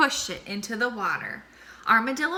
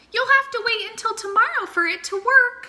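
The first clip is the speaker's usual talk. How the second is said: high, fast squeaky mouse voice; 13 semitones higher, 6 dB louder, faster than usual. A narrator's reading. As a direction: chirp it out quick like a tiny mouse.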